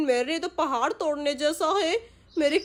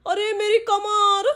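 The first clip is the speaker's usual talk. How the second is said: slow, higher pained wail; pained cry; 5 semitones higher, 3 dB louder, slower than usual. Acted. Father left doing pushups, wailing in pain. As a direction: cry out about the back.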